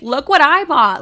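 mock bragging. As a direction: play the braggy influencer. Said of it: "I just don't want it to be like".

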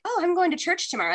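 high, quick innocent quote; high sweet innocent voice. Retold or imitated. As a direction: chirp it out like a good girl. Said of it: "you could be like".

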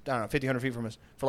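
ordinary delivery

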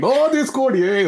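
high, sudden burst of delight; mock excited cheer. Fan at family dinner seeing a goal, excited outburst. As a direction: break out in a pretend celebration.

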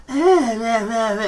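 high, whiny bleating; mock petulant "meh meh" noises; mocking whine. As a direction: imitate a whiny complainer without words. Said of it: "like a typical whiny Portland person".

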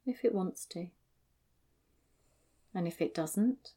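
calm grounding exercise. Acted. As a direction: gently guide attention into the body. Guides body awareness.

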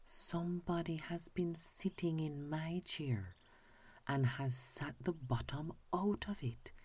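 put-on high, small baby bear voice; little wee bear voice. A narrator's reading. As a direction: squeak it like the tiny bear. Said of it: "little small wee voice".

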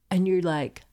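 ordinary talk

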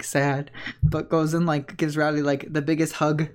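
ordinary delivery

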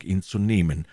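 ordinary delivery, nothing marked

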